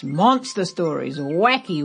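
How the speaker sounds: plain reading voice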